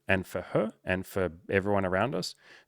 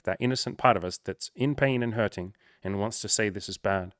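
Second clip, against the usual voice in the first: ordinary delivery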